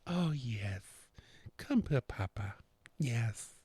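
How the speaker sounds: hushed, soft doting voice; quiet, affectionate murmur; tender coaxing. Real, not acted. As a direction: softly coax a little one over. Coaxing the little one over.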